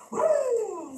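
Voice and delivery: high, drawn-out chainsaw rev; vocal mimicry of a motor revving up; imitated engine roar. Sales lady pulls the cord, imitated chainsaw revving.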